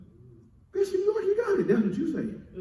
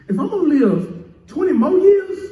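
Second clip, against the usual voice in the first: ordinary delivery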